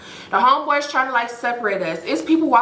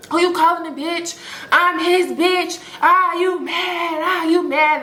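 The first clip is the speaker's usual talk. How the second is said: taunting attitude; 7 semitones higher, slower than usual. Retold or imitated. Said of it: "Bridget and Brooke going back and forth".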